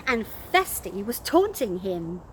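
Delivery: plain reading voice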